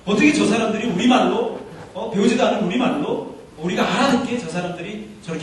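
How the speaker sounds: high, loud, astonished questioning; amazed onlookers. Acted. Preacher voices the crowd hearing their own language, amazed. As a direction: marvel out loud as a baffled bystander. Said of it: "하나는 깜짝 놀라는".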